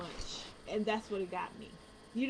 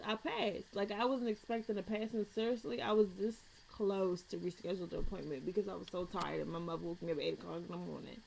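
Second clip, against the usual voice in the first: ordinary talk, nothing marked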